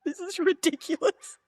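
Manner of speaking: high, amused incredulity; retold disbelief. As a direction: squeak it in mock disbelief. Acts out disbelief at the yelling.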